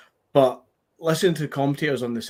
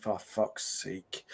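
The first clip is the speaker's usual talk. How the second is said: slow, hushed exasperation; quiet, weary retold groan; exasperated mutter; 5 semitones lower, 10 dB quieter, much slower than usual. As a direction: mutter it low, drawn right out. Retelling weary reaction to VAR check.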